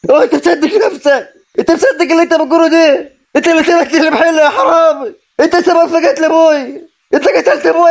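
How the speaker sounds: high-pitched furious tirade; outraged, pitch held high; enraged accusation. Acted. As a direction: drive him away with angry, high-pitched accusations. Throws out and accuses his cousin.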